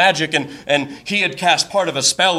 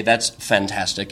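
dry sarcasm; 8 semitones lower, 4 dB quieter, slower than usual. Retold or imitated. Sarcastic reply as date leaves.

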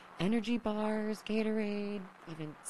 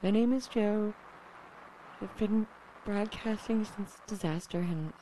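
desperate radio call; 4 semitones higher than usual. Acted. Desperate broadcast intro.

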